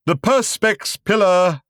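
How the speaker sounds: slow, high, loud showman voice; grand announcement. A narrator's reading. Showman announces the pillar to the audience, grandly. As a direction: announce it like a ringmaster. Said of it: "announced the man".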